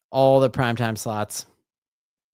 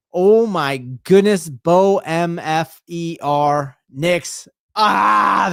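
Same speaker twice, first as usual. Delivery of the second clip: slow, loud drawn-out hype; excited; 3 semitones higher, 6 dB louder, much slower than usual. Real, not acted. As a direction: savor each letter with glee. Reacting to the touchdown again.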